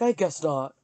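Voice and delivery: a curt snippy "I guess not"; a touch of snark in the quote; mock bitchy reply. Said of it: "And she's like".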